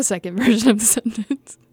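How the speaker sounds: words giving way to a real giggle; laughing. Real, not acted. Reader announcing a second take to the editor, laughing. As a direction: laugh through the end of the announcement.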